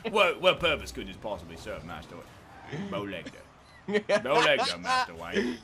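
mock British butler reply; Alfred's Cockney impression. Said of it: "could you do a Jamaican accent".

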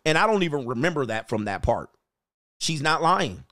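usual speaking voice

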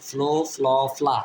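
ফ্লো, ফ্ল, ফ্লা as flo, flaw, flaa; change only the vowel across ফ্লো, ফ্ল, ফ্লা; three sounds of O. Shown as a demonstration. Teacher showing learners O's three sounds, three sounds of O. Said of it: "ও এর উচ্চারণ তিন রকম হয়".

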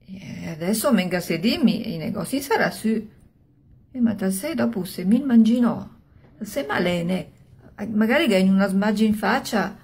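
ordinary delivery, nothing marked

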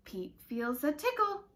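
usual speaking voice

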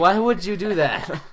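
mock outrage. Bed placed on him again.

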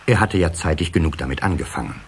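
usual speaking voice

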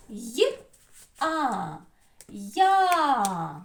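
short Й, separate А, then long loud Я; sounds separated, then blended. Shown as a demonstration. Teacher breaks down the double sound, Й, А, Я sounded out. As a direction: build Я out of Й and А. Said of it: "Подвійний звук".

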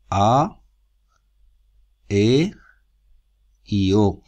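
each vowel called out loud and separate; stressed vowels. Shown as a demonstration. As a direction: call out A, E y O one by one, loudly. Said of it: "que son las abiertas".

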